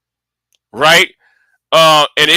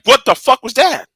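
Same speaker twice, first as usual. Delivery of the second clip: shocked reaction; 7 semitones higher than usual. Retold or imitated. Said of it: "I was like".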